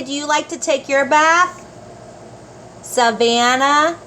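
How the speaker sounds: usual speaking voice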